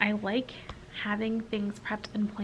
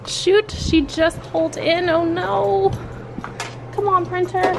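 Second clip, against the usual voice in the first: panicked; 9 semitones higher, 8 dB louder than usual. Real, not acted. Teacher arrives before printing done.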